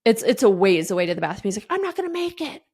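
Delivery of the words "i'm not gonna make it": put-on high, hushed kid voice; little boy who can't hold it; desperate little boy. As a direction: whimper it like a panicked child. Son's plea retold.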